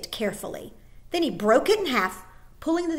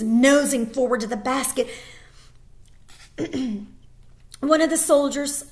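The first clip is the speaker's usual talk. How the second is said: ordinary delivery, nothing marked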